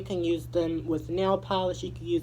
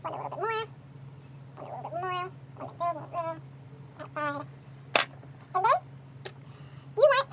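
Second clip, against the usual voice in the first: high squeaky voice; 12 semitones higher than usual. Pretend. High voice directing another person.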